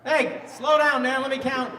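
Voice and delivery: loud, high interruption; calling out. Real, not acted. Comic stopping an eager crowd, loud high call. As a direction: cut in loudly to halt the crowd.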